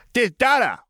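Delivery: put-on very high, excited toddler; small child's voice. A narrator's reading. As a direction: blurt it out like an eager little boy. Toddler thrusts toy at uncle.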